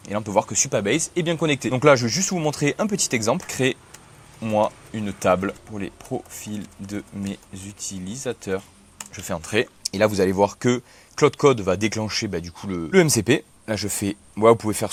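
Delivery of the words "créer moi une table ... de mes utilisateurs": slow, word by word, spaced out; dictating while typing. Real, not acted. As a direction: read it out slowly as you type. (dicté lentement en tapant)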